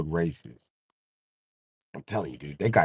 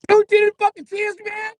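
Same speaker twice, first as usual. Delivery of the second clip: high squeaky outraged yell; 6 dB louder than usual. Retold or imitated.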